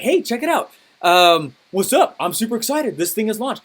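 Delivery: high-pitched, upbeat launch announcement; excited. Retold or imitated. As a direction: act out being super excited. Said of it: "I was excited".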